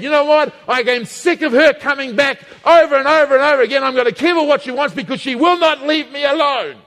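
hammed-up irritation at the nagging widow; fed-up judge. Voicing the annoyed judge.

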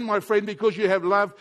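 ordinary delivery

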